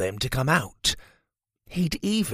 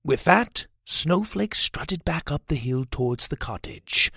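plain reading voice

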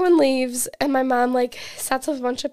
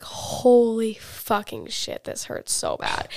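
retold agony; slower than usual. Telling friends how much moving the neck hurt, drawn-out agony. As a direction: stretch the swearing out in remembered pain.

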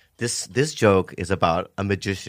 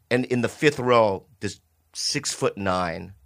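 ordinary talk

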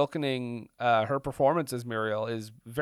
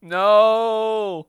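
long drawn-out, higher and louder no; mock protest; 7 semitones higher, 8 dB louder than usual. Co-host objects to the crude producer voice, mock protest. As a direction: wail a big playful no.

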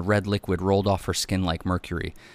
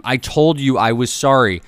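loud, higher exasperated yell; defensive yell; 5 semitones higher, 9 dB louder than usual. A narrator's reading. Husband in bedroom to furious wife, yelling defensively. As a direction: yell the apology back in frustration. Husband yells back in quarrel.

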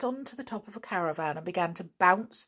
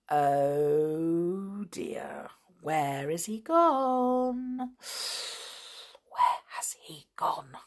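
hushed fretful whisper; worried whisper; much slower than usual. Acted. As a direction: whisper it anxiously, searching. Worried search for the chimp.

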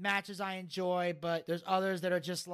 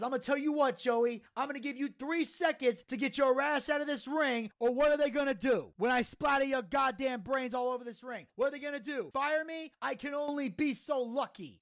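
higher-pitched wrestler threat, retold; passionate promo; 5 semitones higher than usual. Taz orders Joey out of the ring, passionate promo. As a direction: threaten him in a mock promo. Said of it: "Taz coming out with the passionate promo".

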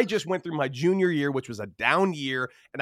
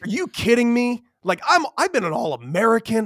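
high, louder disbelief; indignant outburst; 8 semitones higher, 5 dB louder than usual. Retold or imitated. Retelling his outrage at a cocky freshman, indignant. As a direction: protest it, offended.

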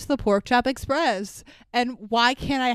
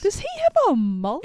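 disbelieving; 9 semitones higher, 3 dB louder than usual. Real, not acted. Host asks about mullet.

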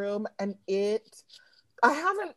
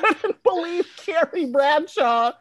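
laughing starstruck; 8 semitones higher, 5 dB louder than usual. Real, not acted.

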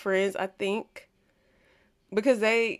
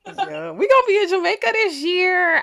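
laughing squeal, high and loud; giddy, laughing through the words; excited laughing; 11 semitones higher, 8 dB louder than usual. Real, not acted. Excited about Jamaica trip.

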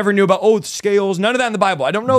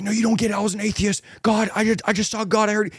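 high-pitched, breathless-style protest without extra volume; retold excited insistence; 5 semitones higher than usual. New convert insisting to preacher he saw God, excited. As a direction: imitate frantic insisting, pitched up.

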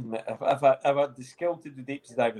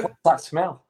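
mock sniff; 4 semitones higher, 5 dB louder, slower than usual. Mock sniffing steel mill air.

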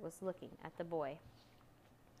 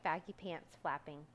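usual speaking voice

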